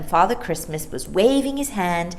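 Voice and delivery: ordinary delivery, nothing marked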